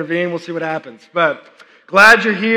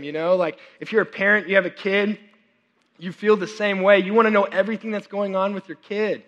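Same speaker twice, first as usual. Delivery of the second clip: ordinary delivery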